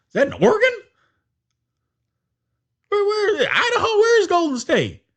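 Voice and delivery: high, mocking, puzzled fan voice; mock clueless fan. Mocking bandwagon fans who never heard of the team, high and puzzled. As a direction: ham up a confused newcomer asking where.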